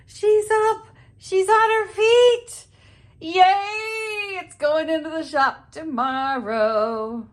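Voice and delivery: very high, loud, delighted squeal; thrilled high-pitched cheer, slowing down; excited squeal. Real, not acted. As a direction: squeal with joy at the finished piece. Furniture finally standing on its legs.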